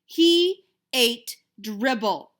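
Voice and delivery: loud, very slow insistence; boy insisting to his doubting mother. Acted. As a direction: press every word. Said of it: "I insisted".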